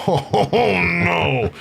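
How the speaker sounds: high, drawn-out exclamation of disbelief; mock outrage. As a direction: draw out a rising, hammed-up objection. Acts outrage at the insult.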